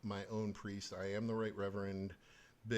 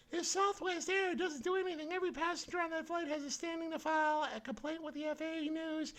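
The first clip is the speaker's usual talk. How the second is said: high, squeaky mock voice of a pundit, much higher; mocking falsetto. Mocking a TV commentator's complaint about the pilot, high mock falsetto. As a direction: sneer her words back in a shrill falsetto.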